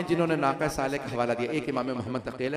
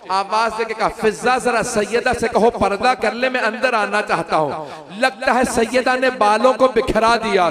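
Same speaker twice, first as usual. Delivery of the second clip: louder, higher mourning recitation that keeps climbing; full-force preaching; 5 semitones higher, 8 dB louder than usual. Acted. Majlis masaib rising to wailing. (ऊँची, ज़ोरदार मातमी मजलिस की आवाज़)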